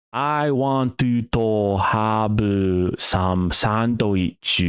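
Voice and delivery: slow, drawn-out mock Japanese-accented English; rough Japanese accent. Texan's example of Japanese English, slow rough Japanese accent. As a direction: stretch each word in a rough Japanese accent. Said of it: "he's got that accent, and he's going".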